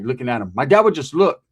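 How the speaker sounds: ordinary talk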